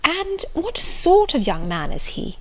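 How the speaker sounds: lightly voiced eager Marianne; eager young girl. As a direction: ask it like a romantic young lady.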